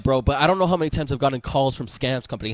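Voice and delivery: ordinary talk, nothing marked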